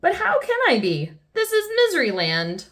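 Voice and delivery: high-pitched mock despair; crying out. A narrator's reading. King despairing to Miss Sunshine, high mock cry. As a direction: lift it into a plaintive storybook cry. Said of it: "cried the king".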